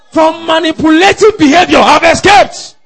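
high, loud preaching cry; triumphant shout. Real, not acted. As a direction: proclaim it at full voice.

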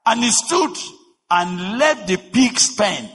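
high, loud impassioned preaching; preaching at full force. Acted. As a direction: drive the story home from the pulpit.

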